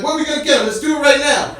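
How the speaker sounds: high, loud, raring to go; pumped-up retold urgency. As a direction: act out a pal urging immediate action. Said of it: "we're really pumped up".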